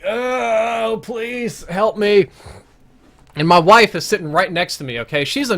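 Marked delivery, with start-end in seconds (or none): 0.1-2.3 hammed-up cry for help, high and drawn out; mock plea for help. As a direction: beg for rescue in a put-on voice, very slowly. Mock plea from under the stripper.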